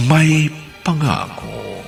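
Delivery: ordinary talk, nothing marked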